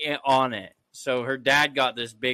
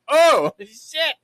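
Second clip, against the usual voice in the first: startled; 10 semitones higher, 8 dB louder than usual. Retold or imitated. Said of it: "I was like".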